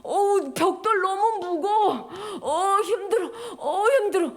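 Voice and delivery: slow, strained groan of a worn-out builder; heaving a heavy load; straining under weight. Acted. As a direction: grunt it out like bricks are too heavy. Workers straining at heavy bricks.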